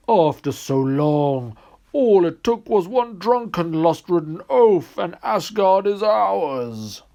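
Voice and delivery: loud, slow, high-pitched triumphant giant voice; gloating frost giant. Acted. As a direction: savor the victory, slow and loud.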